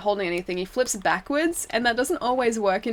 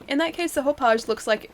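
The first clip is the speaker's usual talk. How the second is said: ordinary talk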